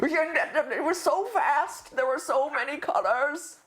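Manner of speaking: high, slow mock old-timer; old man. Host reminiscing on Genesis, high mock old-man voice. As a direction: marvel like a hammed-up old codger. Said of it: "I'm an old man".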